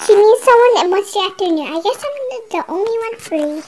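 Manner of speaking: ordinary delivery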